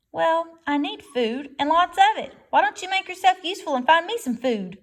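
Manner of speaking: fox bosses the robot around; sly fox. A narrator's reading.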